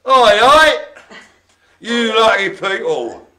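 lightly voiced Cockney cheer, loud and high; Cockney character. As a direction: shout Oi, oi like a Cockney comic. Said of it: "a bit of a Cockney character".

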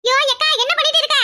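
high, alarmed exclamation; alarmed cry. Acted. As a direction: cry out in dismay at the scene. Friend arrives amid the quarrel, alarmed. (பதறிய உயர்ந்த குரல்)

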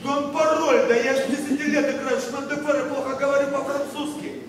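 hurt pride exploding into a loud retort; indignant outburst. Acted. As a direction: bristle and shout him down.

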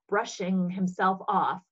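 ordinary delivery

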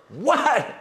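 high, hammed-up "what"; incredulous outburst. Preacher reacting to a refusal of salvation, incredulous. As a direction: burst out in mock disbelief.

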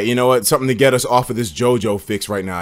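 usual speaking voice

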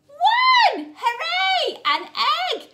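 high, loud delighted cry; excited shout. Acted. Teacher cheering a found egg for the children, loud and high. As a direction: shout it like finding treasure.

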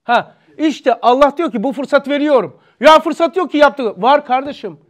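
loud, high, impassioned sermon voice; forceful preaching. Acted. Preacher pressing the congregation, loud and impassioned.

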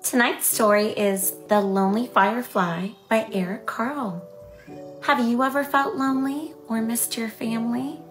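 plain reading voice

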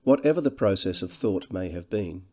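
ordinary delivery, nothing marked

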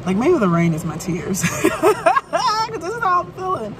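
laughing through it, high; bitter little laugh about tears; rueful laugh. Real, not acted. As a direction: laugh at her own sad joke. Joke about rain as tears.

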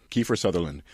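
normal talking voice

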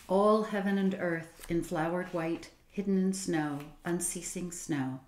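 slow, measured poem recital; slow gentle haiku recital; recited haiku. A narrator's reading. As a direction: lay each line down slowly like a poem. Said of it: "Mister Tiffany Jones recited".

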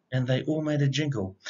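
ordinary delivery

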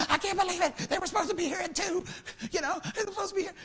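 high, fast, exasperated mock host voice; hammed-up fret over late guests; fussy host. Mimicking a relative upset that guests are late, exasperated.

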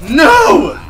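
high, loud cry of protest; sudden loud, high outburst; frustrated shout. Real, not acted. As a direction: yell it out in dismay. Dies or loses in the game.

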